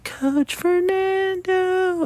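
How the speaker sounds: put-on high, slow, drawn-out kid's call; distant child's sing-song shout; child calling from afar. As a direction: stretch it high like a little girl calling across the field. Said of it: "in the distance, I hear".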